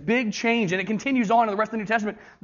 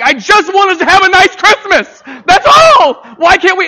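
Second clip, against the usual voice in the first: exasperated movie-dad meltdown, shouted high; loud, high-pitched comic outburst; Clark Griswold; 12 semitones higher, 15 dB louder than usual. Acted. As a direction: yell it like a dad at breaking point. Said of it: "Clark Griswold in Christmas Vacation".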